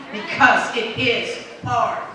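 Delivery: building to a loud rousing climax; rousing speech shout. Real, not acted.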